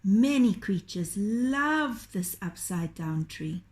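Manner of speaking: usual speaking voice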